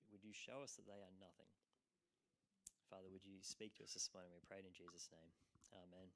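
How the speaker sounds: hushed pastoral prayer. Acted. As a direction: pray it gently for the church. Pastor leads opening prayer.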